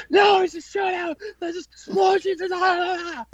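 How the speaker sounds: very high-pitched hysterical mock shriek; hammed-up manic panic, breaking into wailing; manic uncle impression. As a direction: imitate a frantic man raving in terror. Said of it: "got this fucking manic look in his face".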